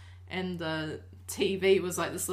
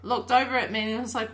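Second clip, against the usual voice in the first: usual speaking voice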